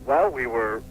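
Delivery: normal talking voice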